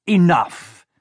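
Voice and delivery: villain silencing his henchmen; stern villain. A narrator's reading. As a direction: cut them off. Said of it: "he said".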